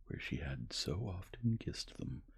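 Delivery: ordinary delivery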